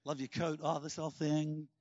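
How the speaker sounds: low, soft, mock dreary small talk; hard-work person. Hard-work people answering small talk, mock downbeat and low. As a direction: imitate someone deflating every pleasantry. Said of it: "people who are hard work".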